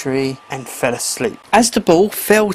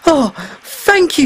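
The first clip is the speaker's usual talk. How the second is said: higher, thankful voice; grateful mer boy; 8 semitones higher than usual. A narrator's reading. Freed mer boy thanks the mermaid, lightly voiced. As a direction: thank her with relief.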